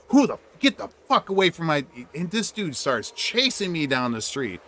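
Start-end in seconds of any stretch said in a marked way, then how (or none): 0.1-1.9 angry yell. Retold or imitated. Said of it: "He's like".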